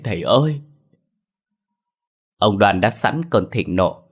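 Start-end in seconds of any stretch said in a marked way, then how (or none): 0.0-0.6 begging. A narrator's reading. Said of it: "khẩn thiết van xin".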